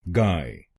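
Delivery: गाय said alone as a clean syllable; syllable demo. Shown as a demonstration. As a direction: model the syllable गाय for learners. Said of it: "पहले शब्दांश को बोलिए गाय". (शब्दांश गाय अलग से बोलें)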